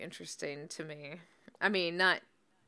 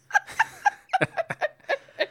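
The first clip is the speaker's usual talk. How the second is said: snorting laughs; 7 dB louder than usual. Real, not acted. Laughing fit.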